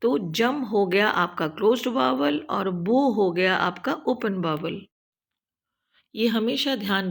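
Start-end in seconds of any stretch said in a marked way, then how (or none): 0.3-0.6 जम said with a short a, not yoo; short vowel sound. Shown as a demonstration. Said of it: "यू का उच्चारण short sound होगा यानी कि अ". 2.7-3.0 बो said with a long open o; long vowel sound. Shown as a demonstration. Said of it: "ओ का उच्चारण उसका long sound ही होगा".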